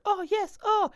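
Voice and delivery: high-pitched, slow fake moans; mock moaning. Host showing how to perfect your moaning, mock moaning. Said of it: "if you wanna perfect your moaning".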